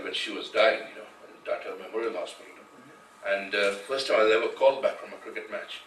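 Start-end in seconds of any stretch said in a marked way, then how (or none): none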